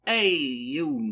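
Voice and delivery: low, badly faked Brooklyn accent; shaky amateur stab at Brooklyn accent; attempted Brooklyn accent. Shown as a demonstration. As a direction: try out a rough Brooklyn accent. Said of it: "gonna try to do a Brooklyn accent".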